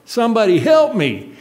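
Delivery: high, loud desperate yell up a tree; shouting for rescue, alone in the woods; hollering for help. Retold or imitated. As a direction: cry out for anyone to hear. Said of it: "I started hollering".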